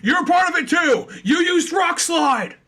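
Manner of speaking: loud, high mock accusation; accusing shout. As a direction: shout the blame back, hammed up. Acting Bruno blaming his Geodude.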